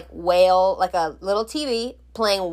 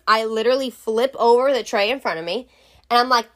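ordinary delivery

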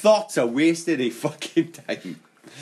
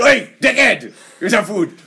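loud, raised-pitch dog's greeting; rude talking dog; 2 semitones higher, 6 dB louder than usual. Retold or imitated. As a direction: call out rudely as a cheeky dog. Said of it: "I do want my dog walking and he goes".

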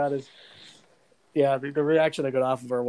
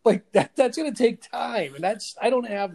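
mock fussy whine; 8 semitones higher than usual. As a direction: whine it like a spoiled adult. Mocking a fussy complainer.

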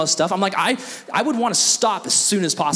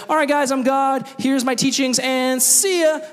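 breezy, higher mock announcement, drawn-out sign-off; Jesus; 8 semitones higher than usual. Imagined quick visit to earth, mock-breezy farewell. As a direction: toss it off cheerily, stretching the goodbye. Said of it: "He could have just come down to earth and be like".

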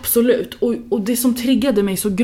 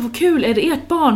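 higher, delighted congratulations to a new parent; bright excited greeting; 8 semitones higher, 3 dB louder than usual. Retold or imitated. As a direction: gush over the baby, pitched up. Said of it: "jag bara".

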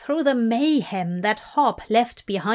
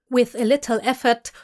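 usual speaking voice

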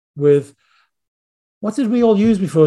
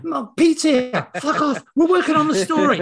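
high-pitched, exasperated son act; mock screaming; 11 semitones higher than usual. Son telling mom they're working on the story, mock scream. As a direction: imitate a son fed up with interruptions. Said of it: "and he'd be screaming".

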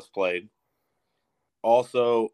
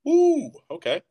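impressed; 4 dB louder, slower than usual. Real, not acted. Impressed at rating.

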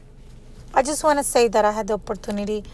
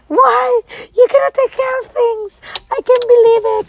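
high, loud mock scolding; scolding friend; 9 dB louder than usual. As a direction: imitate the bossy friend. Mad friend voiced.

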